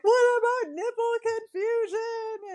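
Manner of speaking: high, slow tearful whine; mock sobbing question to the nurse; retold crying. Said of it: "And I'm crying".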